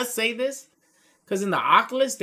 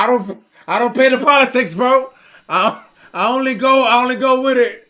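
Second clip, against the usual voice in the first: raised, insistent voice; retold loud protest; 6 semitones higher, 11 dB louder than usual. Player refusing to join the vote, loud mock protest. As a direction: protest it loudly, pitched up.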